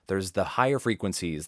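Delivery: normal talking voice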